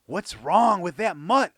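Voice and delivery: loud, slow, fed-up cry; frustrated cry. A narrator's reading. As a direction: vent at the missing dog. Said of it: "I cried".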